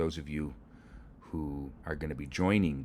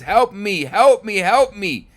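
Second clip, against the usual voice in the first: much higher, much louder panicked cries; panicked shouts; 18 dB louder, slower than usual. Acted. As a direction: scream for help fleeing a bull. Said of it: "the inspector shouts".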